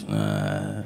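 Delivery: very low dull grunt; wordless phone-answer noise. Retold or imitated. Man picks up his phone, low dumb grunt. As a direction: answer with a deep, clueless groan. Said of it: "answers the phone with a noise".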